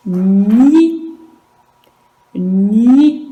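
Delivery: "ni" called out loudly and distinctly; loud dictation. Shown as a demonstration. Teacher dictating the syllable to pupils, loudly. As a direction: project "ni" to the class. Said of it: "teacher dictating a syllable".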